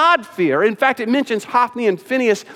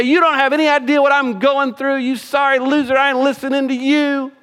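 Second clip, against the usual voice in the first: mock yell back; 7 semitones higher than usual. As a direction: act out a sassy comeback. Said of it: "She didn't yell back at Elkanah".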